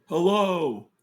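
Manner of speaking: high, loud call across a room. Acted. Crew calls to people across the room, calling out loudly. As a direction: holler it out to be heard far off. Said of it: "Crew's gonna call out from across the room".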